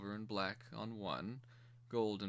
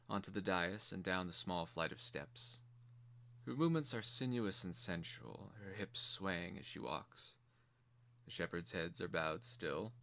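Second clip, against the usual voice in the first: plain reading voice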